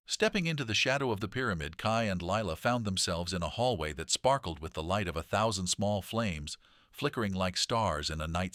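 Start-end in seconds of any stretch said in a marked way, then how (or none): none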